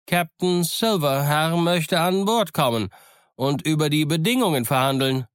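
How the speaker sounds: high, stretched-out hail; calling out. A narrator's reading. Mutineer announces Silver to the captain, calling out high. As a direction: announce it across a distance. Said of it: "rief er".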